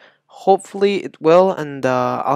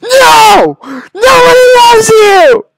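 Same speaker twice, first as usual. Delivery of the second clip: shrieked rage, very high and loud; high-pitched screaming; 12 dB louder, slower than usual. Real, not acted. Gamer blaming the game maker, high-pitched screaming. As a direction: scream until the voice shoots up. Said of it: "I'm getting all high-pitched and shit".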